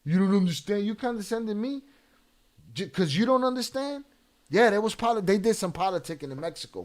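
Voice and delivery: high, loud, incredulous retort; indignant comeback. Real, not acted. Host throws chatter's question back.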